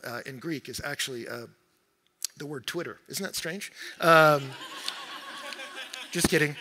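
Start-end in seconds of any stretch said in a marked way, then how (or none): 4.5-6.0 breathy laugh at his own joke. Real, not acted. Pastor laughing at his own Twitter joke, breathy laugh. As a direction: laugh it off, airy and light.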